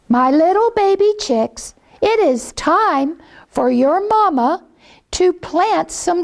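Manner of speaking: high, sweet mother hen talking to her chicks; mama hen coaxing her babies. Acted.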